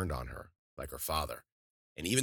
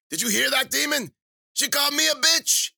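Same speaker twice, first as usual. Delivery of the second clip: very high, loud witch's squawk; shrieking, delighted crone; uproarious glee; 10 dB louder than usual. Acted. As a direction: crow with mirth to the pet. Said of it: "She burst out laughing".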